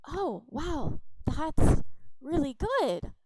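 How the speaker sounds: princess, impressed by the goblin. Acted. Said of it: "our princess goes".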